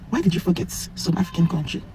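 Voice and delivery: ordinary talk, nothing marked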